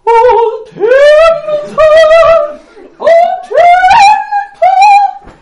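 very high, loud sung falsetto soprano; lady soprano voice. Shown as a demonstration. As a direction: sing it like a hammed-up operatic lady. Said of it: "in a lady soprano voice".

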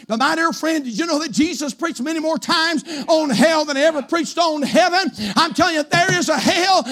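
high, driven pulpit preaching; full-force preaching. Real, not acted. Preacher warning the congregation of hell, full-force preaching. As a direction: preach it with long, leaning stresses.

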